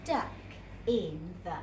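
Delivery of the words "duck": plain reading voice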